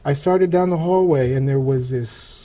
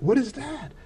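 high, hushed startled question; 9 semitones higher, 8 dB quieter than usual. Retold or imitated. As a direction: gasp it out, caught off guard. Startled by the wall.

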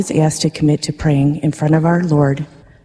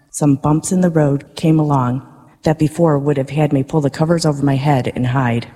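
ordinary talk, nothing marked

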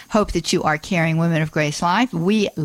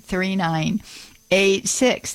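usual speaking voice

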